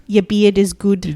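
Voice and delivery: put-on slow, deadpan New Zealand accent; New Zealand accent quote. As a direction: quote the Kiwi comedy duo in their accent, unhurried. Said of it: "quotes from Fly the Conchords 'cause they are from New Zealand".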